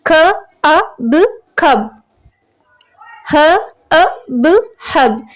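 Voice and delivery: "ख, अ, ब, खब" sounded out sound by sound, then blended; sounding out words. Shown as a demonstration. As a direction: sound out "hub" as ह, अ, ब. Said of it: "दोनों के middle में ये अ साउंड आ रही है".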